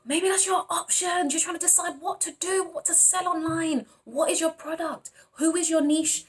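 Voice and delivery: bubbling over with excitement for them; excited. Real, not acted. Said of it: "I'm excited for you".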